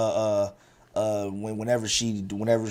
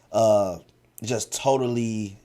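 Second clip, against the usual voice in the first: ordinary delivery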